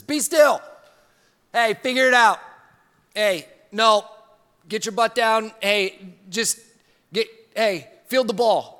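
loud, high barking coach; coach yelling. Retold or imitated. High school football coach yelling at his player. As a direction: bark orders like a football coach.

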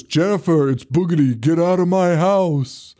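hammed-up bogeyman warning, stretched at the end; spooky shadow figure. Big shadow scaring Jennifer away, hammed-up spooky voice. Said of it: "the big shadow goes".

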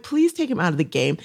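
usual speaking voice

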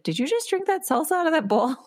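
high, disbelieving question; retelling her shocked question about the salsa bowl; incredulous. Retold or imitated.

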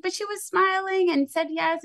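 high, pleading disbelief; incredulous protest. Retold or imitated. Answering the head of school, high incredulous protest. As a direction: protest like it makes no sense. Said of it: "And I was like".

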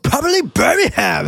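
put-on quoting a Cockney girl in a thick Cockney accent. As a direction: put on the broadest Cockney you can. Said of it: "with the thickest British Cockney accent ever".

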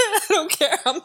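high-pitched, cracking up while quoting; laughing retelling. Retold or imitated. Co-host repeats kid's cheeky comeback, laughing hard. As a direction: repeat it through helpless laughter.

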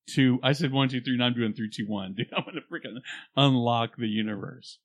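usual speaking voice

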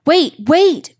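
high, loud desperate plea; a panicked shout for time; frantic cry. A narrator's reading. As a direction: cry out to stop the lion. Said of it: "cried Hassan".

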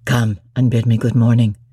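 low, deep-voiced master; deep, commanding lover's voice; Rochester. A narrator's reading. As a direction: drop low into a gruff gentleman. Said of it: "said he".

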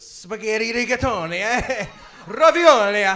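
loud, high, hammed-up Italian accent; mock Italian. Shown as a demonstration. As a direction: belt it out like a cartoon Italian. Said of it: "and it says Italian".

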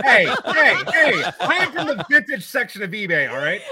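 a real laugh breaking into a loud, high protest. Real, not acted. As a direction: crack up while protesting the joke. Joking banter, laughing.

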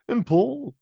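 high Paul voice; [name] voice. Acted. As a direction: lift it up into Paul. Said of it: "[name]'s".

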